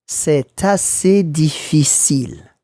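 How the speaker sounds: slow, clear "C'est assez difficile"; teacher slowing down "C'est assez difficile"; slow model phrase. Shown as a demonstration. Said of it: "Try saying that".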